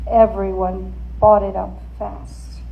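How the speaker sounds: ordinary delivery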